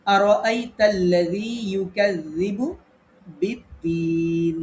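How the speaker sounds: very slow, measured tajweed recitation; Quran recitation. Shown as a demonstration. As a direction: recite with long held vowels, joining the doubled letters. Teacher recites a Quran example. (ধীরে টেনে তেলাওয়াত)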